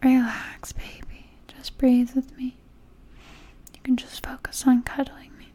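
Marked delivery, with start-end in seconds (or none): none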